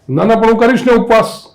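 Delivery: put-on high, loud, insistent little boy; pleading like an eager child; eager child's protest. (ઉત્સાહી બાળકનો ઊંચો અવાજ)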